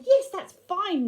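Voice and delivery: put-on high, loud child voice; eager little boy voice; Harry voice. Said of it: "And Harry said".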